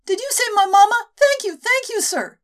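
put-on very high, loud childlike gushing; a tiny girl thanking the men tearfully; crying little girl. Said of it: "cried into their trousers".

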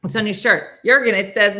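ordinary delivery

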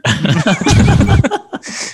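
brrr money printer noise; printer whir sound. Pretend. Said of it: "my fun money printer noise".

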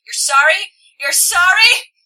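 loud, high incredulous questions; rising, outraged echo; scoffing disbelief. A narrator's reading. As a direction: throw the apology back in disbelief. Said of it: "my voice comes out in a laugh".

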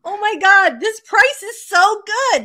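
overexcited. Retold or imitated. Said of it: "we're like".

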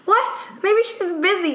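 imitating a baffled man in a high pitch; high mock-incredulous reply; incredulous partner. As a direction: question it in exaggerated disbelief.

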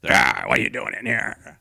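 low gruff boss voice; deep puppet villain voice. Retold or imitated. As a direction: growl it like a devil boss. Voicing Vince McMahon puppet.

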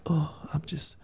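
high, breathy, whispered fake sorrow; a syrupy, hushed imitation of a weepy Jesus; mock sentimental Jesus. Pastor mocking the soft weepy Jesus, hushed and high. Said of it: "So Jesus was not like".

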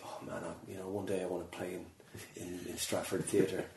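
very soft, low musing; private wishful thought. Retold or imitated. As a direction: voice a hushed daydream to yourself. Voices own old private dream.

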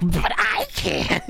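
slow, drawn-out menace; masked villain reveal. Acted. Fake officer unmasks to the orphan, slow menacing reveal. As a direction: stretch it out as a mock villain. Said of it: "Rips off his mask".

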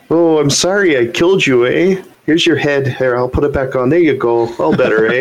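a sorry, polite Canadian killer putting a head back on; mock Canadian accent. To friends, mocking Canadian horror in a playful Canadian accent. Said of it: "We've made fun of Canadians".

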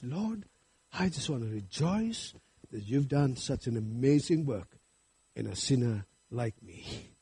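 very soft, low, humble prayer; hushed prayer. Acted. Preacher voicing a sinner's thanks to the Lord, hushed and reverent. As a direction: speak privately to God in awed gratitude.

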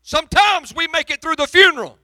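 loud, high, pleading preacher's cry; preaching at full force. Acted. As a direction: hurl the questions at heaven, full force. Sermon climax.

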